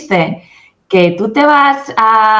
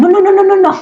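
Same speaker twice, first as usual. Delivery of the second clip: mock refusal; 12 semitones higher, 3 dB louder, faster than usual. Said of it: "me ven la cara y dicen".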